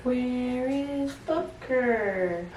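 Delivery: very slow drawn-out question; slow teaching voice. Real, not acted. Mother asking baby where the dog is, very slow. Mom echoing slowly to baby.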